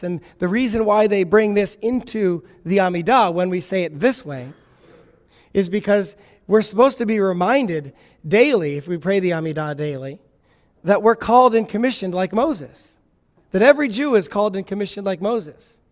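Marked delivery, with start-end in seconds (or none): none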